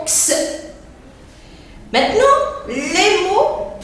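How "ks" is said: X said as the two sounds "ks"; sound demo. Shown as a demonstration. Teacher showing the sound in double-C words, X as "ks". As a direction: give X as a bare "ks" sound. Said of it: "on entend le son X".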